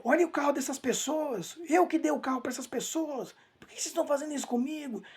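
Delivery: imitated high-pitched outcry, without much volume; retold yelling. Disturbed father protesting about people from afar, imitated high yell. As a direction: mimic his complaining cries, pitched well up. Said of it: "ele gritava assim".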